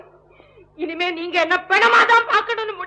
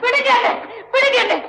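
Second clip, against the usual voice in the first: frightened plea; 6 semitones higher than usual. Acted. Struggling free from the king.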